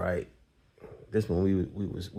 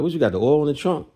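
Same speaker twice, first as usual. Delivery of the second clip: higher, louder and fast, confused; surprised question; 7 semitones higher, 6 dB louder, faster than usual. Retold or imitated. Confused at the car trunk, fast surprised question. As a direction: blurt it out in disbelief.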